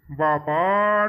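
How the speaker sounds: long, drawn-out gasp of disbelief; shocked exclamation. Acted. Husband stunned by his wife's rakhi total, drawn-out exclamation. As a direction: stretch it out, stunned by the bill.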